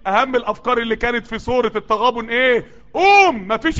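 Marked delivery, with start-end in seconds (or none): 2.9-3.5 high, loud قوم; rally the crowd to rise; crowd-rousing cry; shouted refrain. Acted.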